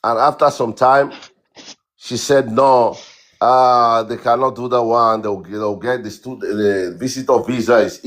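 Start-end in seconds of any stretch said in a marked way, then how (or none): none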